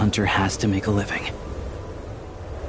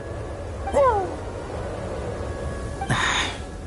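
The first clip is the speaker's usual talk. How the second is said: long sigh; 4 dB quieter than usual. Acted. Exasperated at bad counting.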